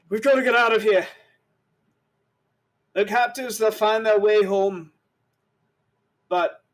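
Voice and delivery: loud, high urgent warrior voice; urgent escape call. Acted. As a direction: urge them to flee, loud and high. GM voices Pragan urging escape.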